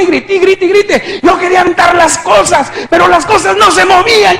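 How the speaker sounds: high, loud, rushed retelling; frantic shouting. Retold or imitated. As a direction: pour it out in escalating cries. Reliving his frantic screaming out of body.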